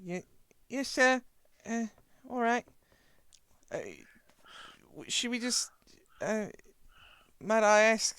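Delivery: high, timid servant voice, halting; deferential servant. Acted. Servant asking sir about the old fellow, high and deferential. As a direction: pipe up high and hesitant, politely.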